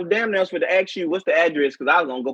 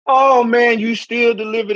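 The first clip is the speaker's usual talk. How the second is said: loud laughing exclamation; 5 semitones higher, 5 dB louder than usual. Real, not acted. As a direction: shout it while laughing.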